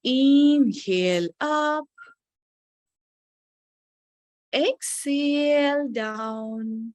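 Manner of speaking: very slow breathing cue. Shown as a demonstration. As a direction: pace the words to a full breath.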